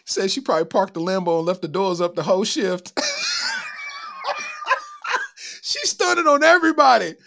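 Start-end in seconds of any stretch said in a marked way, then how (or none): 3.0-5.7 high shrieking burst of laughter; real howling laugh; screaming laugh. Real, not acted. Cracking up at the Lambo. 5.7-7.2 loud, higher cry of delight; excited outburst. Real, not acted. Amused outburst after laughing.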